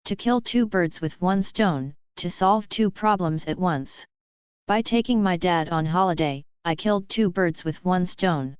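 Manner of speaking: ordinary talk, nothing marked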